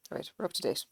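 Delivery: usual speaking voice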